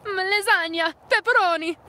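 high, loud hammed-up Italian; mock Italian accent. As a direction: belt out food words in a caricature Italian accent. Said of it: "makes fun of Italian accent".

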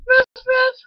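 playful puppy bark. Acted. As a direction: yip like a small puppy. Dog barks.

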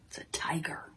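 breathless frightened whisper; scared whisper. Acted. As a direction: whisper it like the tiger might hear. Teacher acting scared of tiger.

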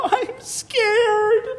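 high teary little otter voice; little otter crying to Mom; scared cry. Acted. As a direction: cry it out like a frightened pup. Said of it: "Otto cried".

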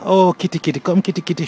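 high, sing-song calling to a kitty; coaxing a cat. Retold or imitated. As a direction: coo to coax the cat over. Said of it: "And you dey pan".

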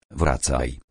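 usual speaking voice

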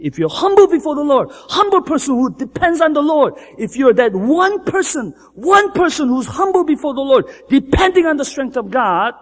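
fervent sermon climax; preaching at full force. Acted. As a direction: lift the congregation with it. Preaching build.

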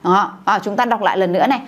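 normal talking voice

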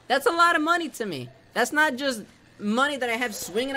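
high, loud upset cry; plead, voice pushed up. Real, not acted.

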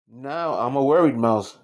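usual speaking voice

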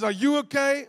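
high, loud repeated check; imitating an urgent call; retold shout. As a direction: shout it over to a struggling man. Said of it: "and he shouts".

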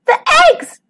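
very high cry of dismay; high-pitched, distressed exclamation; alarmed cry. A narrator's reading. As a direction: cry out in shock, voice leaping up. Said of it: "she cried".

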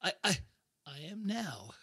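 soft, smitten stammer; seductive. Acted. David answering the woman who rescued him, seductive. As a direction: go soft and smooth, falling for her. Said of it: "seductive saxophone emotion".

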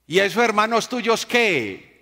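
put-on high, loud call of a deaf old man; old man shouting. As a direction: shout across the whole bus. Old man questioning loudly. (el viejito grita a todo el bus)

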